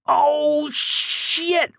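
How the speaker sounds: put-on old man's shrill roar of dismay. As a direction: yell it as Jack falls through. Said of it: "roared Duke again".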